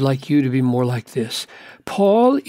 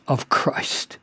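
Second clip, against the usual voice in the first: whispered ending; 2 semitones lower, 5 dB quieter than usual. Acted. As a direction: whisper the last words, softer. Quoting Paul on enemies of Christ.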